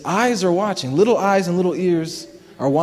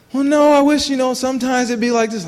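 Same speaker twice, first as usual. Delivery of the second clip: voicing wife; 7 semitones higher than usual. Wife's retorted complaint in quarrel.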